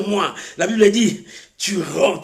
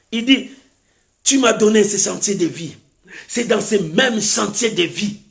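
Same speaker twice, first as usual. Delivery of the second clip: normal talking voice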